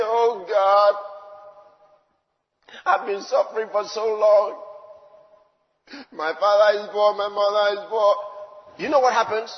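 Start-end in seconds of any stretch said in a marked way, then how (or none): 0.0-8.2 louder, pleading whine of a sufferer; mock complaining prayer. Preacher mimicking a believer whining to God. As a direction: play the self-pitying believer lightly. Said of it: "When you say".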